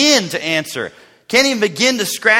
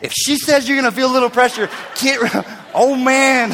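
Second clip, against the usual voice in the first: high, hurried, breaking into laughter; mock alarm; comic frantic warning; 7 semitones higher than usual. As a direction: warn them urgently, half laughing. Reenacts warning others in lobby.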